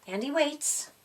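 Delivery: plain reading voice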